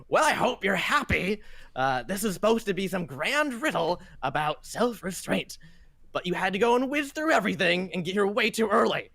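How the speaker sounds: put-on high, peeved old man scolding; an old caretaker annoyed at early guests; grumpy old man. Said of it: "and he's just like".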